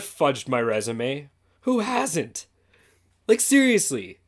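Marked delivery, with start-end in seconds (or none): 1.6-4.2 mock indignant. Real, not acted. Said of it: "rhetorical joke".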